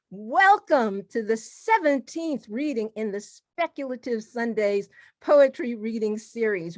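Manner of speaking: normal talking voice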